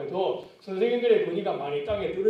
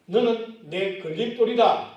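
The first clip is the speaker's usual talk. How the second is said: slow, louder, each word weighed; blunt rebuke; 3 semitones higher, 5 dB louder, slower than usual. Acted. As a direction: lay it down plainly.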